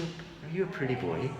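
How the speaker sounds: ordinary talk, nothing marked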